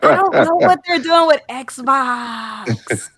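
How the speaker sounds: slow words through real laughter; laughing. Real, not acted. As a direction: laugh while saying it.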